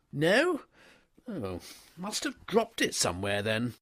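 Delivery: put-on an easygoing young master stopping his valet; young toff. A narrator's reading. Wooster shrugs off the loss.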